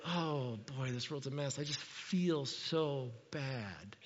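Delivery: hushed, slow, mopey sigh of fake sympathy; mock pity. Preacher imitating a God who only feels bad, in mock pity. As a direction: lament "this world's a mess" while doing nothing.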